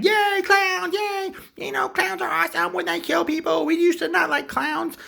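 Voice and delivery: high, loud gleeful squeal; cheering crowd. Acted. City celebrating killer clowns, high squealed cheer. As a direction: cheer like a giddy mob.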